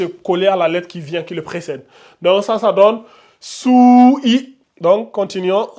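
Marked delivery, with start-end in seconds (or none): 3.4-4.6 "sou" held long and higher, then a short "i"; voyelle allongée. Shown as a demonstration. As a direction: stretch the vowel of sou before adding i. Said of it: "on l'allonge en deux secondes". (« sou » allongé, puis « i » bref)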